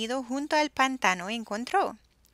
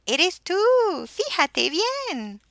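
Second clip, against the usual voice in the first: laughing; 9 semitones higher, 6 dB louder, slower than usual. A narrator's reading. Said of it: "Y riendo le dijeron".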